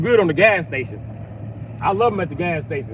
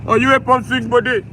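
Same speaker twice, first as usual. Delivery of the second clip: put-on light, louder Indian accent, friendly; 3 semitones higher, 4 dB louder than usual. Indian cashier telling the customer his pump, light Indian accent. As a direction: imitate a helpful Indian cashier. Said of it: "Tell an Indian guy".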